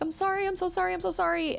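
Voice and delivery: put-on high, pleading little kid apology; upset child's voice. A child begging the parent for forgiveness, high and tearful. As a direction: plead like a small child who broke something.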